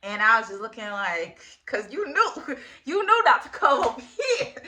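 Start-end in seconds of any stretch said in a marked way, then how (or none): 2.7-4.5 talking through a laugh, pitched up. Real, not acted. Teasing him about coming over, laughing. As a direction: let the laugh lift the words high and loud. Laughing as she tells the story.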